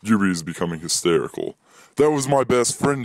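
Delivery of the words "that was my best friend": crying out. Acted. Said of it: "he cries".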